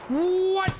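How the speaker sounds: very high, silly British squeal; mock British accent. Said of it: "I'm the worst at accents".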